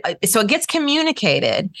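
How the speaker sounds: ordinary talk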